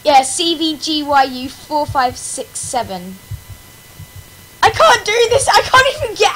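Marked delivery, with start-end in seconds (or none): 4.6-6.3 high, loud cry of frustration; loud "I can't do this"; frustrated outburst. Real, not acted. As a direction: blurt it out in despair. Frustrated at the game.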